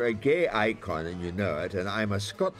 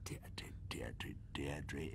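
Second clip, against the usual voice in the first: patronising hush; 7 semitones lower, 16 dB quieter, much slower than usual. Real, not acted. Patronising lecture to director.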